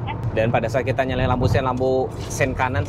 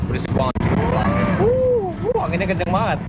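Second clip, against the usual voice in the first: shouting over wind. Real, not acted. Sunroof open, loud wind.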